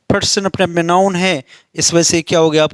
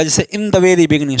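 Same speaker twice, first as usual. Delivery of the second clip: ordinary delivery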